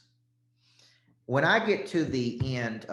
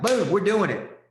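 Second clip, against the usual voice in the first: hyped up; 8 semitones higher, 7 dB louder than usual. Acted. Coach hyping a decision moment.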